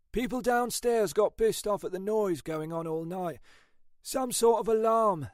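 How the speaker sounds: high-pitched police colleague giving a report; animated, high-voiced officer; Ashford's character voice.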